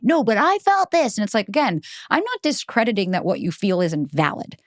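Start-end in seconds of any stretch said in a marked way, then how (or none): 0.0-1.2 high, drawn-out mimicked protest; mock insistence. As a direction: imitate someone who must be right. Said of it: "They always have to be right".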